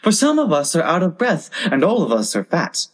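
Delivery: high-pitched oyster plea, easing down at the end; small creatures protesting in high voices; oysters crying out. A narrator's reading. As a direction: pipe up high to stall them. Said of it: "the oysters cried".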